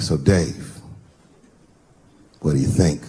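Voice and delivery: deep-voiced buddy. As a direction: sink low and ask it lazily. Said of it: "He was like".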